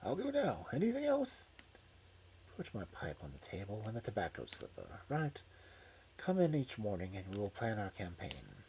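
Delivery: soft, low sickbed voice; weak injured Holmes. Acted. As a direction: give orders weakly from bed. Injured Holmes giving instructions.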